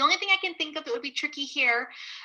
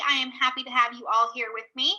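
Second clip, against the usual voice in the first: usual speaking voice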